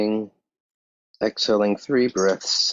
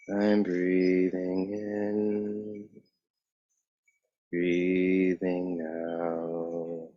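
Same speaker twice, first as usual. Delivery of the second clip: drawn-out meditative words, gradually fading softer; slow breathing cues; 3 dB quieter, much slower than usual. Real, not acted. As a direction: stretch each phrase over a long breath, trailing away.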